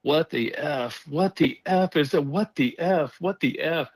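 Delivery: high, stunned what-the-F outburst; stunned wife swearing in disbelief; a wife hears a ghost voice, shocked swearing. Retold or imitated. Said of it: "started dropping F-bombs like".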